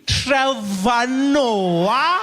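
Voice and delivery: slow, high, stretched disbelief; mock surprise. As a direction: drag out his name in disbelief. Receptionist recognizes him.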